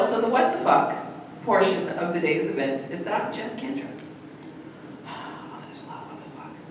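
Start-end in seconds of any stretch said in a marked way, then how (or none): 5.1-6.6 weary whisper. Real, not acted. Exhausted aside.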